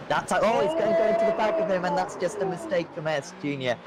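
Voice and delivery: sudden high alarmed call; excited race call. Real, not acted. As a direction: call the crash as it happens. Collision called live.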